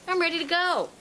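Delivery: retold burst of readiness; eager declaration. Retelling decision to move.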